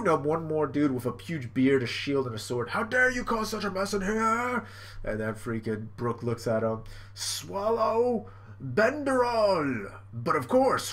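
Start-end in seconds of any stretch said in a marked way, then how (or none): 2.7-4.6 high, mock-outraged challenge; hammed-up affronted cry; indignant guard. 7.1-9.9 high, very slow, stretched move name; hammed-up slow battle cry; attack call. Brook calls his attack.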